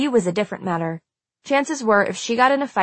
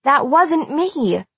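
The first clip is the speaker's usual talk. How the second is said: high, drawn-out denial; protesting; 7 semitones higher, 3 dB louder, much slower than usual. A narrator's reading. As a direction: deny it indignantly. Nora protests accusation mid-fight.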